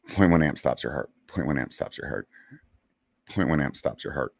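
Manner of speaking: quiet, fading self-talk mantra; repeated to himself. Retold or imitated. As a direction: murmur it over and over like a prayer. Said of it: "telling myself over and over again in my head".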